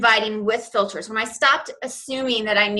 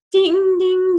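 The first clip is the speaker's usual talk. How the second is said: mock notification ding; 9 semitones higher, 5 dB louder than usual. Imitating phone notification sounds.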